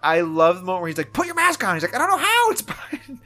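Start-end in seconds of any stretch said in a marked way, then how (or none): 1.1-1.8 high-pitched mock command; imitated urgent order. Retold bickering order in van. 1.9-3.2 high-pitched mock protest, tailing off in volume; imitated bickering. Retold bickering reply.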